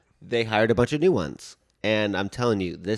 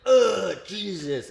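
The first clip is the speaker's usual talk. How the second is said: groaning complaint; 8 semitones higher, much slower than usual. Retold or imitated. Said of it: "I was, like, going like".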